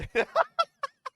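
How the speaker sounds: high shrieking laugh bursts out; loud burst of laughter. Real, not acted. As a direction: cackle hard and loud. Explosive laugh at friend.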